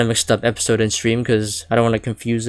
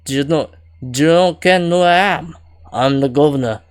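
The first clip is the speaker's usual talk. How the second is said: higher, louder Scottish governor; punchy, raised Scottish character voice; Scottish accent; 4 semitones higher, 6 dB louder than usual. Acted. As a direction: announce yourself in a Scottish accent. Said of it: "I'm just gonna go Scottish".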